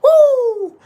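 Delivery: high-pitched screamed whoop; Hamza Chimaev. Acted. Imitating Hamza at press conferences, loud high scream. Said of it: "I remember when he would always scream".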